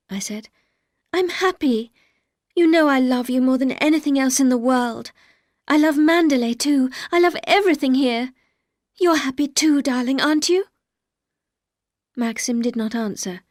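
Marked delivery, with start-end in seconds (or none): 1.1-10.7 higher and louder, rushing to reassure; eager pleading. A narrator's reading. Young wife insists to her husband she is happy, eager and loud. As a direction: pour it out, desperate to convince.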